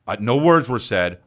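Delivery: ordinary delivery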